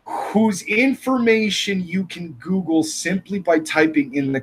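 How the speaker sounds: mocking. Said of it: "Somehow that constitutes as a dox".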